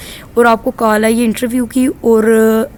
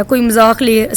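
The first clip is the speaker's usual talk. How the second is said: usual speaking voice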